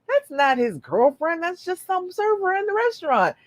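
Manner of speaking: the all-seeing one soothing her gently; all-knowing God voice. Pretend. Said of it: "and you're like".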